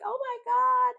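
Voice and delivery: high, loud squeal of joy; squealing excitement. Retold or imitated. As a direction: shriek it like the girls seeing their friend back. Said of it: "the girls are just like".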